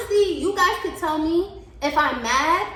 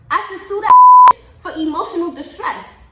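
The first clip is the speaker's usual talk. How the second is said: loud outburst; 6 semitones higher, 12 dB louder than usual. Real, not acted. Angry outburst about boss.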